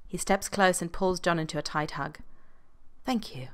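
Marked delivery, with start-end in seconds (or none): 3.0-3.5 softer, intimate murmur; host breathing thanks into John's ear; breathed softly. Acted. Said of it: "he breathes in his ear".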